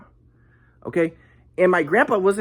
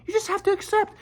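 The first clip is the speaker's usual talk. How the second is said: higher, preachy mock-calm voice; mock guru grandpa; 6 semitones higher than usual. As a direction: parody the spiritual grandpa soothing. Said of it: "my grandpa's like".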